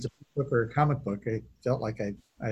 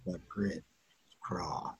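much softer, low, half-whispered mumble; mumbled imitation; 6 semitones lower, 11 dB quieter, slower than usual. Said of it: "That's the thing".

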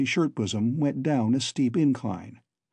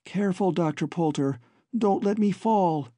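high, careful plea; mild nervous plea; 6 semitones higher than usual. A narrator's reading. Babs asks Poulter to hold her safely, high and a little nervous. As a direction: ask him gently not to drop her.

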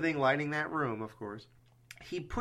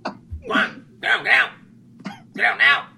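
loud, much higher urgent warning; imitating Loomis; 10 semitones higher, 11 dB louder than usual. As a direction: bark the warning like a frantic doctor. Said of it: "Loomis is at that".

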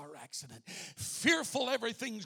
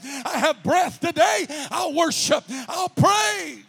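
loud, higher, climbing to a shout; full-force preaching; 4 semitones higher, 11 dB louder than usual. Acted. Preacher rallying the congregation, shouting praise. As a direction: build into a praise cry.

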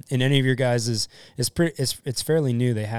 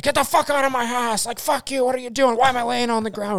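high, loud mock yell of a dazed man; angry confused patient; 13 semitones higher, 5 dB louder than usual. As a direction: shout at the medics like a confused, furious patient. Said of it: "And he's like".

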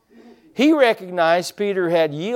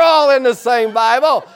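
laughing through the words at his own mistake; 6 semitones higher, 5 dB louder than usual. Real, not acted. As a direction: chuckle while telling the mix-up. Preacher laughing at his own mix-up.

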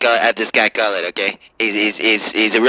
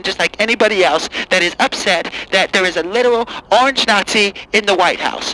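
higher, whiny outraged liberal; angry liberal caller; 6 semitones higher than usual. Acted. Caller ranting at the host in a higher outraged voice. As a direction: rant like a fed-up caller. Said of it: "Hi, Angry Lib".

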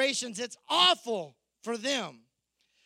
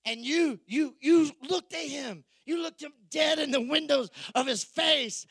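louder and higher, full-force preaching; preaching shout; 5 semitones higher, 5 dB louder than usual. Real, not acted. Pastor retelling Jesus' promise, loud higher preaching shout. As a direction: drive it home to the back row.

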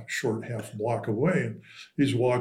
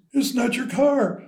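higher, louder mock protest; retold indignant retort; 11 semitones higher, 4 dB louder than usual. Driver answering dad who grabbed him, higher and louder mock protest. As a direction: snap back like the offended driver.

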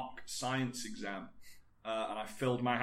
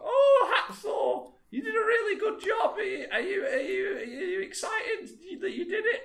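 shrill, much higher, breathless mock interviewer; excited interviewer; 6 dB louder than usual. TV interviewer quizzing the strongman, shrill and gushing. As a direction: gush like an overexcited TV interviewer. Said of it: "the interviewer's like".

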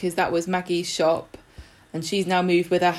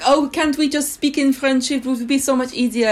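high, loud mock French girlfriend; imitating the French girlfriend, higher and louder; 8 semitones higher, 4 dB louder than usual. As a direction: squeal it like an eager French woman. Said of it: "She's like".

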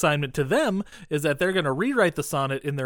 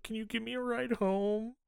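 small pleading voice, higher and much softer; sad; 8 semitones higher, 8 dB quieter than usual. Retold or imitated. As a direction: ask meekly, sorry for herself. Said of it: "All sad".

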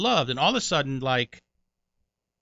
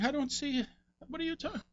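confused; 7 dB quieter, faster than usual. Retold or imitated.

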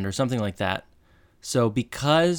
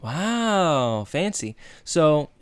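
usual speaking voice

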